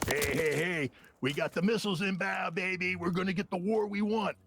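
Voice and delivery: hammed-up bragging American, pitched high; high, cocky mock brag. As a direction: swagger it like a gloating official. Mocking Albright bragging to French.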